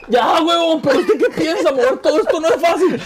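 high, strained, laughing through the pain. Real, not acted. Cramping friend answers his cohost, strained high laugh through pain. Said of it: "No, está llorando".

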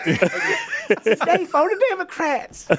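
put-on very high, slow old mom voice; a mocking impression of his mom. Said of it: "you make your mom sound like she's in Roots".

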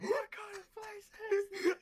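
very high, slow, loud strained voice through laughter; coughing SpongeBob. Retold or imitated. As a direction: choke it out while drying up. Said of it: "He's like".

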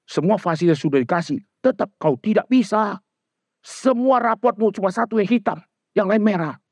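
high, fed-up mock mother listing what she gave; mother scolding her child over bad grades, high and whiny; ranting mother. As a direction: complain like an exasperated mom.

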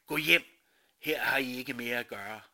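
loud, forceful order to go home; booming command to disperse; commanding shout. Acted. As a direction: drive the crowd away with a shout. Narrator commands crowd to leave.

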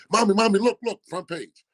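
high, loud, urgent calling out; acted excited shout for Mommy; excited little sister. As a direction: imitate a kid bursting in with big news. Acts sister running in with newspaper.

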